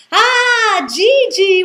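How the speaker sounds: loud, high joyful greeting shouted across a bar; joyful cry. Retold or imitated. Bar regulars shouting a welcome to their friend, loud and joyful. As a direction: call out his name with delight. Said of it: "went the cry".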